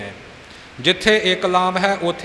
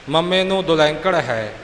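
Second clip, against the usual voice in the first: ordinary talk, nothing marked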